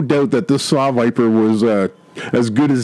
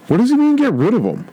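confused henchman; 11 semitones higher, faster than usual. Retold or imitated. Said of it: "go like".

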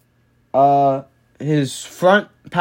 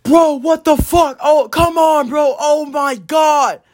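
freaking-out shout, much higher and drawn out; Justin; 12 semitones higher, slower than usual. Retold or imitated. Imitating his friend yelling over the crashed car, freaking out. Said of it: "Justin was freaking out".

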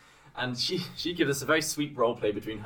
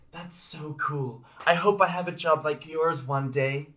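put-on a touch of a higher, slow child voice; lightly voiced little girl; 5 semitones higher, slower than usual. The daughter admiring her mother's job, in a lightly raised child voice. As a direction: gush like a small admiring child. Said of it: "Then the daughter".

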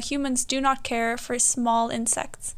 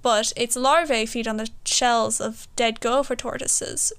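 ordinary talk, nothing marked

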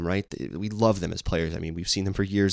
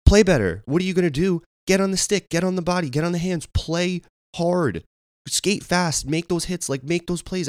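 high, louder ranting at the player; exasperated rant; 10 semitones higher, 4 dB louder than usual. Retold or imitated. Fan venting at a sulking player, high exasperated rant. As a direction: rant at Foxy like a furious fan.

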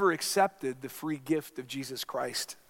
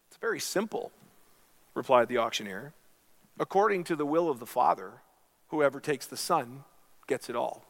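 storytelling voice in a sermon; story narration; 2 semitones higher, 5 dB louder than usual. Acted. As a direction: tell the parable to the congregation.

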